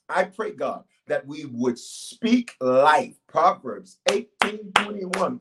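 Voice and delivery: slow, earnest prayer voice; preacher leading a congregation in prayer. Acted. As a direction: pray it over the people, drawn out. Leads a prayer.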